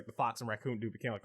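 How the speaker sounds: ordinary talk, nothing marked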